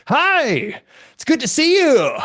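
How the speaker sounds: over-projected greeting. Retold or imitated. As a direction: overdo the projection like a first-day student. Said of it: "learn how to project more".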